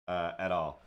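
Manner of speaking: ordinary talk, nothing marked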